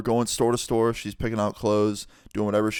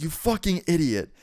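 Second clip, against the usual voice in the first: higher-pitched indignant insult; retold anger; 5 semitones higher than usual. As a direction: re-enact an outraged comeback. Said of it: "I was pretty pissed off".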